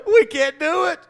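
mock crying coward; mock weeping soldier. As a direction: play a grown soldier blubbering. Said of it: "starts weeping and crying".

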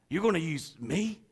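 can't believe it's me; quiet disbelief; incredulous. Acted. Moses protests to God.

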